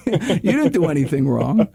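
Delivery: ordinary talk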